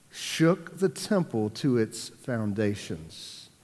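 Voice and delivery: slow dramatic. Acted. Dramatic slow scripture line.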